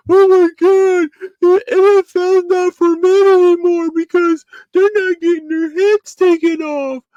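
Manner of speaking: high, loud mocking voice of a complaining fan; mocking whiny fan. Vlogger mocking fans who miss big hits, in a high loud whine. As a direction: sneer it out like a whiny football fan. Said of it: "I hate when somebody".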